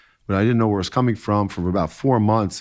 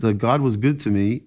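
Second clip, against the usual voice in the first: usual speaking voice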